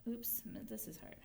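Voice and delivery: very soft mutter to self; quiet aside. Real, not acted. Teacher struggles with the marking, muttering very softly to herself. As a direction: barely voice it.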